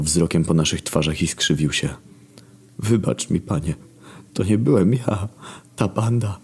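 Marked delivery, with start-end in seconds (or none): 2.8-6.4 lightly voiced servant asking pardon; archangel Michał. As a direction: beg forgiveness of the Lord. Michał pleads with God.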